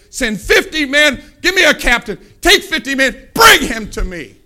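loud, high-pitched royal orders; commanding shout. Acted. King ordering fifty men after the prophet, loud and commanding.

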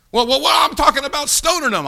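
high, loud objector butting in; mock protest.

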